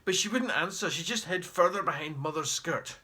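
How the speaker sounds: ordinary delivery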